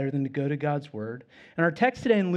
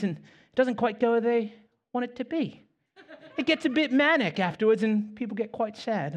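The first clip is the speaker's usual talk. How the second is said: higher British imitation, a bit grim; British accent; 6 semitones higher than usual. Pastor quoting a British man on the street in a higher British accent. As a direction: butcher a British accent, pitched up. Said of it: "I'm gonna butcher the accent".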